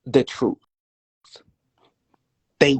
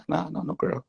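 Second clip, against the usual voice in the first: ordinary talk, nothing marked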